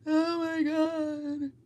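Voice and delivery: high, slow, whiny mock plea. Mocking people who want sympathy, in a high slow whine.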